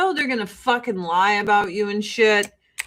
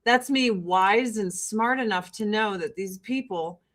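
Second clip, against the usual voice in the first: ordinary talk, nothing marked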